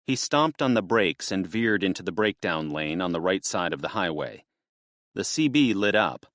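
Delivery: ordinary delivery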